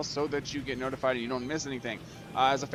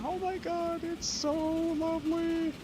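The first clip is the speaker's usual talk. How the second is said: much higher, slow, drawn-out gush; gushing delight; much slower than usual. Real, not acted. Admiring a scenic screenshot, high gushing delight. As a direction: swoon over the view.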